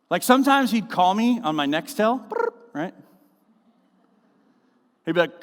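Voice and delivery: ordinary talk, nothing marked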